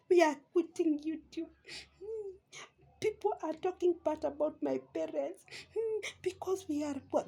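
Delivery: high, whimpering fake sobs; mock crying. Vlogger mimicking Agi's quitting video, mock crying. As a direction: imitate a tearful plea with little sniffly hums. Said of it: "I can pretend I'm crying".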